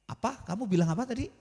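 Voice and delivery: soft, mock puzzled asking; feigning deafness. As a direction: play hard of hearing to dodge the question. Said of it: "Pura-pura budeg".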